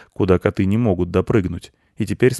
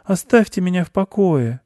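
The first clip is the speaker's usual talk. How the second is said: high-voiced weary mouse plea; sad pleading; 12 semitones higher than usual. A narrator's reading. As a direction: beg sadly in a small high voice. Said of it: "попросил мышь печально".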